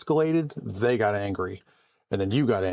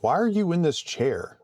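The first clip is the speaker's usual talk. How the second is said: raised pitch, baffled question; mock incredulous; 7 semitones higher than usual. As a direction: retell it in puzzled disbelief. Baffled at doctor's wife shoplifting.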